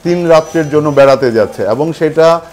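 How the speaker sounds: loud, high announcer voice; booming prize announcer. Acted. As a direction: call it out to the whole hall. Host announces the Bali prize.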